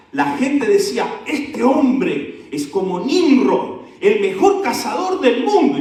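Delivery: high, loud, slow proclamation; full-force preaching. Acted. Preacher proclaiming Nimrod's fame to the church, full-force preaching. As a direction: declaim it to the whole congregation, stretching the big words.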